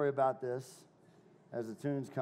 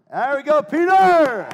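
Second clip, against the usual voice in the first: high, full-voiced holler; cheering shout; 13 semitones higher, 17 dB louder, slower than usual. Real, not acted. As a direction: cheer the helper on loudly, stretching the name. Cheering on helper at demo.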